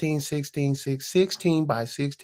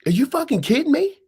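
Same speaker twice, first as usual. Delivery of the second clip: mock outburst; 12 semitones higher, 6 dB louder, faster than usual.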